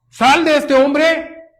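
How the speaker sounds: slow, loud, high order; ringing quoted expulsion; acted command. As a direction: command the demon to leave. Quotes Jesus expelling the demon.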